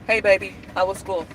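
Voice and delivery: ordinary talk, nothing marked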